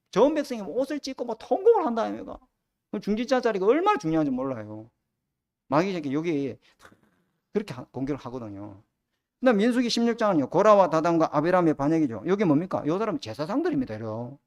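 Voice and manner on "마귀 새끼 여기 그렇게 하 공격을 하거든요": normal talking voice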